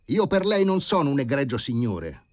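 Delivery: brusque. A narrator's reading. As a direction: snap it back, offended. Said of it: "comunicò bruscamente". (secco e brusco, più forte)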